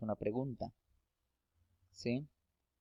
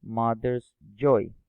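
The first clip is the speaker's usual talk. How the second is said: slow English example; 9 dB louder, slower than usual. Shown as a demonstration. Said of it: "en inglés vemos cómo es".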